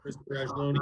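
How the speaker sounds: faint, distant mic; barely audible. Real, not acted. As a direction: far from the microphone. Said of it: "you're barely audible".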